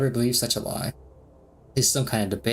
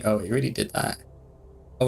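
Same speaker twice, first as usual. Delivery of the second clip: ordinary talk, nothing marked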